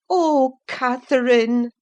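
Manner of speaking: high, loud grieving cry; sorrowful cry. A narrator's reading. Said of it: "I cried sorrowfully".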